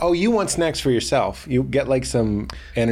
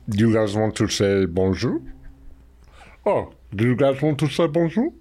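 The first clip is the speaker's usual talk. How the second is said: Andre the Giant; 4 semitones lower than usual. Retold or imitated. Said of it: "my only Andre the Giant impression".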